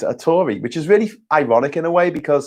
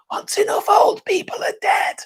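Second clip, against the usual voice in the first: cheeky aside. Acted. Cheeky aside on old voters.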